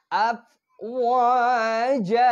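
slow chanted আফওয়াজা; tajweed recitation. Shown as a demonstration. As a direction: stretch আফওয়াজা slowly with a clear জিম. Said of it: "ওই যে জিম শিখিয়েছি".

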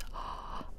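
soft, hushed gasp of delight; whispered gasp. Real, not acted. Gamer reacting to a new mask's power, soft gasp. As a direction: gasp quietly under a whisper.

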